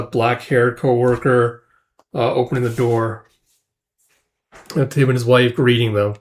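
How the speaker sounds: ordinary delivery, nothing marked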